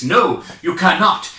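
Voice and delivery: usual speaking voice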